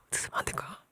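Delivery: breathy hushed asking; whispered gentle plea. Acted. Paul gently asking Philemon a favor, whispered. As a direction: whisper the request tenderly. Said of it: "이런 거예요".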